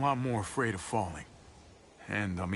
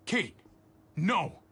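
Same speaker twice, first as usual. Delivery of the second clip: urgent shout; 7 semitones higher, 3 dB louder than usual. Acted. Trying to stop shooting.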